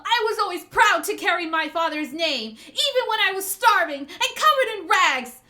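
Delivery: loud, proud, indignant speech; impassioned denunciation. Acted. Hiyori denouncing the shogun's dishonour, indignant. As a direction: drive each accusation home forcefully.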